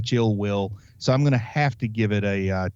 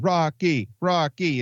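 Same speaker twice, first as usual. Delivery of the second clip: put-on chanting Rocky in a Russian accent; Russian accent crowd chant; 5 semitones higher, slower than usual. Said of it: "in Russian accents".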